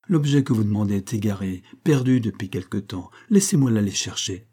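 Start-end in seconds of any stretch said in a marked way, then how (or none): none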